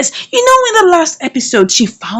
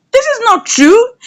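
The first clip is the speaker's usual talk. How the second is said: rising disbelief; 11 semitones higher than usual. Retold or imitated. Kelly's friend protesting his confession, pitched high. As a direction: repeat it higher and rising in disbelief.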